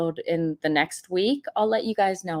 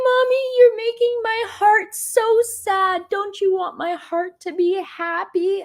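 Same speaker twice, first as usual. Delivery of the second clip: put-on high, pleading little child voice; imitating her toddler; 13 semitones higher than usual. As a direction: beg sweetly like a small boy. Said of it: "he's like".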